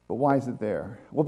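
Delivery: ordinary talk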